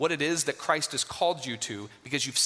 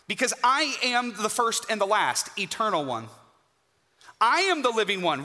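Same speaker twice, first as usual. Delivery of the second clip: higher and louder, proclaiming each phrase; preacher voicing Christ, soaring and triumphant; preaching at full force; 6 semitones higher, 4 dB louder than usual. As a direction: thunder out the risen Christ's words like a herald.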